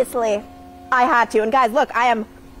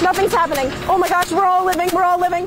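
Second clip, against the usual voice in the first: usual speaking voice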